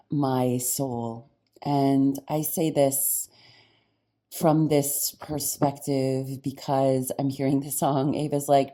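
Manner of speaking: ordinary talk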